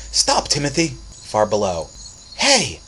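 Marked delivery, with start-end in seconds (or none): 0.1-1.0 higher, louder alarmed mother; mother's cry. Acted. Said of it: "he heard his mother cry". 2.4-2.8 much higher, louder leaping shout. Acted. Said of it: "shouted Timothy".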